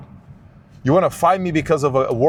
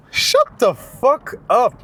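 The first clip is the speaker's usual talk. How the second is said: slow, high fed-up groan; drawn-out exasperated; 8 semitones higher, much slower than usual. Real, not acted. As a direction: stretch it out in disgust. Annoyed at predictions.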